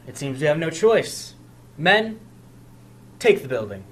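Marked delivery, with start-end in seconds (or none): 1.8-3.8 commanding call. Acted. Commander orders troops.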